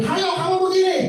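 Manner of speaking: high, bossy mimic of a leader ordering people about; mock bossy leader. Preacher mimics a pushy leader bossing the congregation. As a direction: squeak out the order like a nagging boss.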